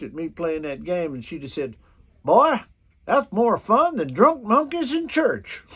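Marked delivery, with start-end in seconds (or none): 2.2-5.6 louder and higher, slow mama voice; a folksy mama's exclamation, hammed up; imitating old mama. Quoting his late mama to Alex, louder and higher. Mama's words retold in story.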